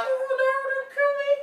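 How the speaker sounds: very high, fast squeal; tiny insect. Acted. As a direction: plead like a bug about to be squashed. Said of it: "like if you stepped on an insect".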